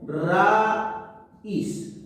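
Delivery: "raíz" in two drawn-out parts, ra... íz; split into syllables. Shown as a demonstration. Teacher showing students the hiatus, "raíz" split syllable by syllable. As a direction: break "raíz" between a and í with a clear pause. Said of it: "yo lo voy a separar así".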